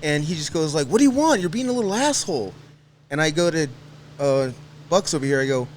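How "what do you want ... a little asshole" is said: higher-pitched, quick mock scolding; imitating an irritated dad. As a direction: snap it like a fed-up dad, retold. Dad snapping at his son, retold.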